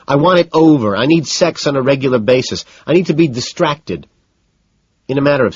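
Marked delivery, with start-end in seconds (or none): 0.1-4.1 louder, much higher frantic outburst; losing control, words spilling out. A narrator's reading. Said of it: "she becomes frantic".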